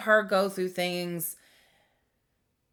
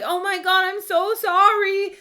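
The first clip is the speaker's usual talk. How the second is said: high, loud tearful re-enacted apology; retold distressed apology; 13 semitones higher, 8 dB louder than usual. Daughter reliving apologizing to her hurt mother, high distressed wail.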